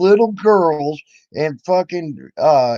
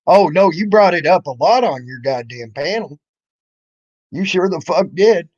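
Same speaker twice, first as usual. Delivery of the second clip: usual speaking voice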